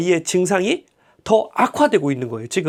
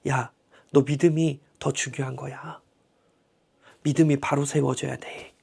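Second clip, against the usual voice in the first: gentle Jesus; 5 semitones lower, 7 dB quieter than usual. Retold or imitated. Jesus' words retold softly.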